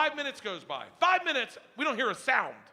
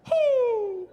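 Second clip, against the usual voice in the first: high, loud owl hoot with a laugh; 8 dB louder than usual. Retold or imitated. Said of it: "I'll get him going".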